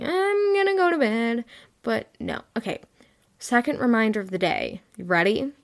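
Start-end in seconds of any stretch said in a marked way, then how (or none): 0.0-1.4 mocking myself. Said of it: "Me saying".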